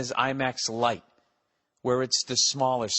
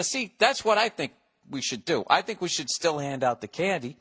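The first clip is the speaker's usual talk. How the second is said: ordinary talk, nothing marked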